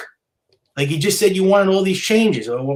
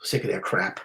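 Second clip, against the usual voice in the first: whispered aside; 7 semitones lower, 8 dB quieter than usual. Real, not acted. Vlogger fed up with the team, whispered under his breath. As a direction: whisper it, much softer and lower.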